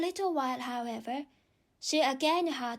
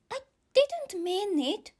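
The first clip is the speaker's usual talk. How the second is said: put-on high, slow pleading little girl; 9 semitones higher, 4 dB louder than usual. A narrator's reading. Alice pleading with the offended mouse, high and slow. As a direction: beg him not to take offence, slowly. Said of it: "pleaded poor Alice".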